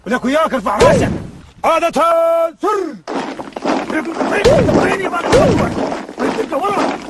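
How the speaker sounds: barked order. Acted. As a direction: bellow at the recruit slowly. Sergeant yelling at recruit.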